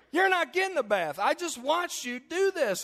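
very high, loud mock scolding; hammed-up indignant refusal; exasperated mother. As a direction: imitate a mom at her wits' end.

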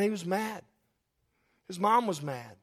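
ordinary talk

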